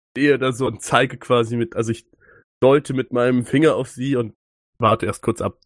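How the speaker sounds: normal talking voice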